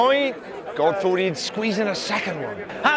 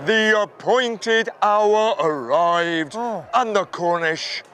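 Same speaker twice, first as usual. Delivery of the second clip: slow, low, grave lament; a deep, slow, grieving divine voice; sorrowful God; 5 semitones lower, slower than usual. Acted. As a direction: mourn over wayward children like a deep old god. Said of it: "says God".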